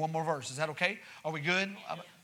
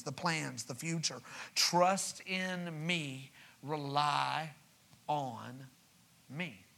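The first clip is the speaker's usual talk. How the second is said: solemn scripture voice; 3 semitones lower, 4 dB quieter than usual. Acted. Preacher reading God's words to the church, soft and reverent. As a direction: hush it down, let each promise land.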